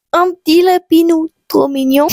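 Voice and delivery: high, cooing little voice. Real, not acted. Vlogger cooing at a cute bunny bookmark, in a high little voice.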